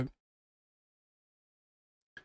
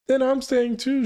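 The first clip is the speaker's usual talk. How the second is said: lightly voiced child, pitched way up; stubborn child; 10 semitones higher, 3 dB louder than usual. Child refusing to leave her mother, a hint of a high child voice. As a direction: insist like a small kid refusing to leave. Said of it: "she says".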